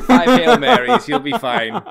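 high, loud laughing mock priest. Joking along as the dismissive priest, laughing mock. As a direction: toss it off like a bored priest, laughing.

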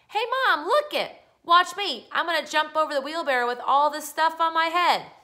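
put-on loud, high-pitched boyish show-off call. A narrator's reading. Boy showing off to his mom at the window, calling out. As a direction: shout excitedly for mom to watch. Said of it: "he called out".

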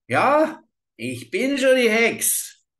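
high, loud, slow gloating witch; witch's triumph. A narrator's reading. Witch reveals herself to the girl, loud gloating. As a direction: reveal yourself with a cackling shout. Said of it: "Und die Alte lachte".

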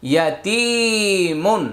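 ইয়াতিমুন with a long তি; slow recitation, holding তি in ইয়াতিমুন; madd stretch. Shown as a demonstration. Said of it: "জেরের বাম পাশে জজমলা ইয়া".

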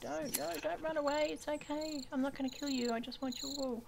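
high-pitched reassuring animal talk; coaxing. Real, not acted. Player chasing a sheep for wool, coaxing. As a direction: coax a skittish animal to stay. Coaxes fleeing sheep not to run.

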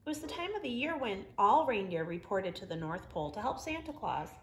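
ordinary delivery

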